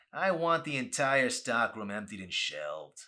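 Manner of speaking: slow, loud bossy order; demanding. Acted. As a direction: lay down the order word by word. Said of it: "Ray demanded".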